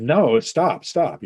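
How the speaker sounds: half-mock alarmed protest; protesting refusal. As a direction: push the pushy helper away. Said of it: "he's like".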